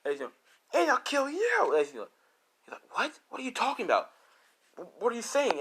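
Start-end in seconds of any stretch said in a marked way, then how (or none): 0.7-1.7 very high, loud, drawn-out character voice; acted crazed threat. Rose threatens Izuku. 2.9-5.6 high-pitched, stammering disbelief; acted shock. Izuku stunned by threat.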